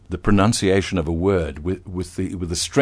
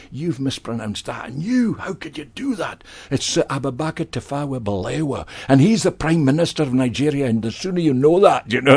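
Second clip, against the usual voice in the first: imitating a fussy advisor scolding staff, higher; mock-scolding the staff at the weekly meeting, higher; pedantic language advisor; 6 semitones higher than usual. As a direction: tick off the newsreader like a pernickety boss. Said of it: "he'd say".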